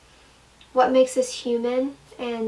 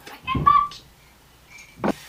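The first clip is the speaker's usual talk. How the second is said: gushing squeal; 4 dB louder, much slower than usual. Acted. Gushing about Ratatouille.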